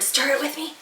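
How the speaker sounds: plain reading voice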